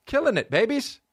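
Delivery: ordinary talk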